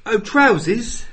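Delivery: high, loud, slow mock English accent; bad English accent. Playing a Yank attempting British, bad English accent. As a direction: draw it out in a silly English accent. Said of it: "trying to do an English accent".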